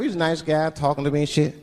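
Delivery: usual speaking voice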